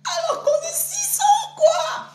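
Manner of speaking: cracked, high-pitched mock yell; mock shout. Livestreamer shrieks mockingly at inflated numbers, cracking shout. Mock outburst about six hundred.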